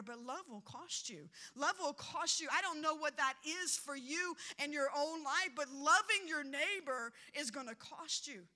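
ordinary delivery

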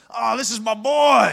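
a lightly voiced proud father, slow; a hint of a booming father; booming dad voice. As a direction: boom it out proudly. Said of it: "He had a booming voice".

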